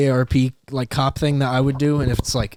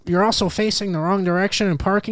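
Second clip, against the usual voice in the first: nerdy officer listing violations; annoying, officious mock policeman; super nerdy cop; 4 semitones higher than usual. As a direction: pile on fines in a raised nerd voice. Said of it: "with the super nerdy voice".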